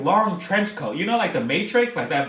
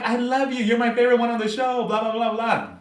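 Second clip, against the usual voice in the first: high re-enacted fan gushing; starstruck, excited fangirl declaration; 6 semitones higher than usual. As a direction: pour out praise to a star, pitched up. Re-enacts gushing to her idol.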